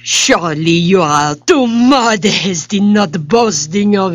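delighted, high French exclamations; French king. Acted. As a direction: gush like a thrilled French king.

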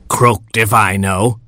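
plain reading voice

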